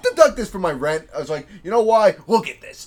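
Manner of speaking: high, loud, building to a yell; mock indignant demand. Tenant confronts the landlord over the filter, mock indignant demand. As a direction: act out an outraged tenant showing proof.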